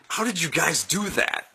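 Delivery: laughing, baffled high voice; amazed like a stoned kid; high amazed question. Retold or imitated. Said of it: "I'm like".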